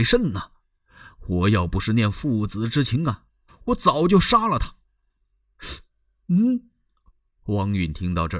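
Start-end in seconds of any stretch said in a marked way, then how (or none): none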